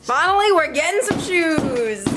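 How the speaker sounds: high, drawn-out celebration breaking into laughter; jokey whoop of relief; gleeful cheer. Real, not acted. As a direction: celebrate playfully, stretching the end. Joking cheer at new kitchen.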